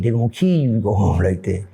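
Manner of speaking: put-on low, serious old man's warning; grave old man. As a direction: drop it deep and grave. Echo in his head.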